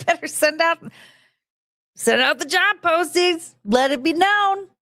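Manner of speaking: high, slow, drawn-out herald send-up; mock proclamation. Telling manager to post the job, mock proclamation. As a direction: sing out a grand announcement in jest.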